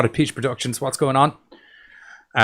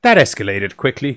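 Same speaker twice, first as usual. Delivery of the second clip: usual speaking voice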